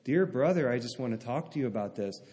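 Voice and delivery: soft reasonable tone, dropping away; calm and gentle. Retold or imitated. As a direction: imitate someone speaking very calmly. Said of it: "I went very calmly and said".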